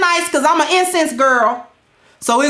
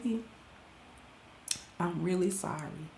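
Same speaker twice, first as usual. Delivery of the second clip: much lower, much softer retake of sorry; practice apology; 9 semitones lower, 22 dB quieter, faster than usual. Shown as a demonstration. Rehearsing a second apology to husband, low and hushed. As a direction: try sorry again under your breath. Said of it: "practicing how I'ma say sorry".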